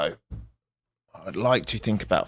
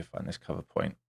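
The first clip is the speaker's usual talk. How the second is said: aside; 12 dB quieter than usual. Real, not acted. Muttering to himself.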